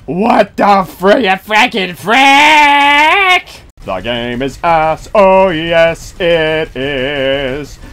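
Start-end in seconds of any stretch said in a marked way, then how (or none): none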